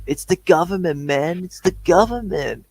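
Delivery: spaced-out conspiracy theorist; lazy paranoid hippie voice. Retold or imitated. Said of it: "I sound like a nutty conspiracy theorist".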